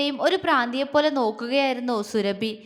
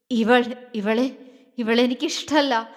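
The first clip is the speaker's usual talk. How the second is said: heavy breathing. A narrator's reading. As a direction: struggle for breath as she speaks. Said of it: "ശ്വാസം വലിച്ചു വിട്ടുകൊണ്ട് പറഞ്ഞു". (ശ്വാസം വലിച്ചുകൊണ്ട്)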